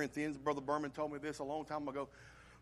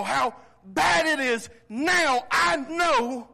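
loud, high, impassioned preaching; full-throated revival preaching; preaching at full force; 9 semitones higher, 11 dB louder than usual. Acted. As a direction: preach it out, voice climbing.

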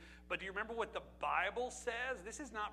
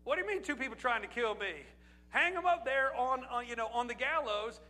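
king, higher and louder, outraged at a plot; alarmed king; 4 semitones higher, 5 dB louder than usual. Retold or imitated. King Ahasuerus hearing of the plot, alarmed and ordering. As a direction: bark it like a startled king ordering a hanging. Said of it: "all he paid attention was".

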